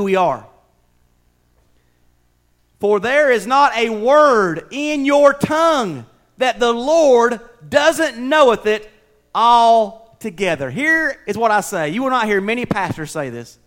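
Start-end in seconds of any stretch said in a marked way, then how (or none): 2.8-10.7 slow, weighty reading of the verse; solemn scripture reading. Acted. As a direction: let each word of the verse land slowly. Reads scripture aloud.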